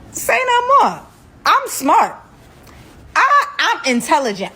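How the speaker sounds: high-pitched mock-frantic assurance; eager compliance. Captive reassuring her kidnapper, comically eager to comply. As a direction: blurt it high, overeager to placate him.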